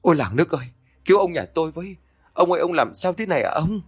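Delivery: mildly voiced sobbing plea; wailing for help. A narrator's reading. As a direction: call out to the neighbours, half-sobbing. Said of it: "run rẩy gào khóc cầu cứu".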